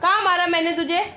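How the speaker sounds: high, insistent, exasperated questions; exasperated questioning. Real, not acted. Mother argues with crying child. (झुंझलाई माँ के ऊँचे सवाल)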